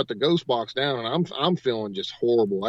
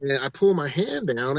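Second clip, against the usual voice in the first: usual speaking voice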